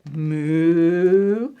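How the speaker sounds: long moo sliding upward; slow, stretched cow moos. Acted. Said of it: "Something that goes".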